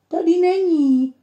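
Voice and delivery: high, loud call across the room; a girl calling to her mother. A narrator's reading. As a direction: call it out from under the bench.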